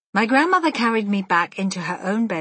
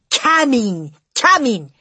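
loud, impatient snap; snapped; 4 semitones higher, 9 dB louder, slower than usual. A narrator's reading. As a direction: snap it at them, fed up. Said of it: "snapped the Grand High Witch".

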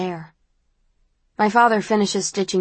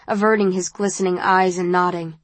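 ordinary delivery, nothing marked

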